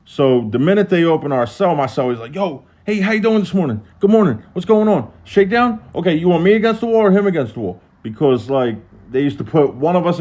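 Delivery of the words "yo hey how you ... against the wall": high-pitched, high-energy greeting chatter; hyper cellmate. Retold or imitated. Cellmate chattering at guards during a shakedown, hyper. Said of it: "he's just high energetic".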